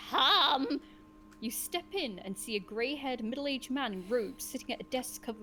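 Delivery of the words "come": sharp, loud bark of a summons; a sharp, quavering "Come!", louder; wavering sharp voice. Acted. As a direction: call them in sharply, a wavering old sage. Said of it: "the wavering but sharp voice answers".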